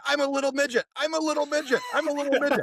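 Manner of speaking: put-on high-pitched gleeful little-kid chant; mock child's yell. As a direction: imitate a kid yelling in a high voice.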